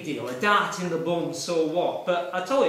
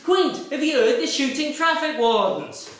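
higher, louder mate with a wind-up question; lively mimicked pub mate; imitating Squirrel; 7 semitones higher, 5 dB louder than usual. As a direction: play Squirrel baiting his friend. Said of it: "He says".

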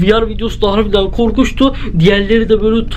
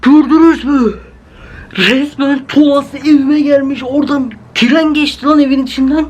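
shaken and frightened; 5 semitones higher than usual. Real, not acted. Telling viewers the train passed through his house, frightened. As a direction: still shaking, tell viewers what happened. Panicked after jump scare.

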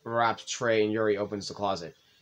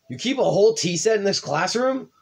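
surprised; 9 semitones higher, 6 dB louder than usual. Acted. Surprised at the tea set.

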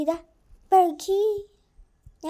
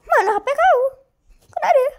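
gasping surprise; 8 semitones higher, 6 dB louder, faster than usual. Acted. Gasping, high put-on voice.

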